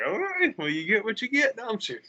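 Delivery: high, mocking shrug of a reply; sarcastic retort. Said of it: "And it's like".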